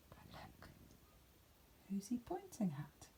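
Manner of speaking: hushed conspiratorial whisper; whispered suspense. Acted. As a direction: whisper a secret. Suspense aside to listener.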